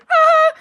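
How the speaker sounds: high, loud mock squeal; short imitated fangirl shriek; starstruck squeal. Women see the star walking over, starstruck squeal.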